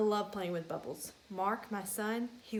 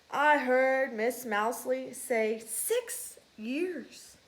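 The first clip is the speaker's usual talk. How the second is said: slow, louder know-it-all; know-it-all mouse; 3 semitones higher, 6 dB louder, much slower than usual. Acted. Field mouse correcting his friend, slow and louder. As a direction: lecture him slowly.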